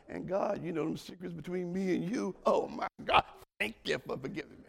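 mildly hushed, confiding prayer; private prayer. Acted. Believer thanking God in secret, private prayer.

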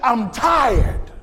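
high, emphatic preacher's imitation of a complaint; impassioned preaching. Preacher voicing the tired churchgoer, impassioned preaching. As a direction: act out the protest in full sermon cadence. Preacher voices weary member at full force.

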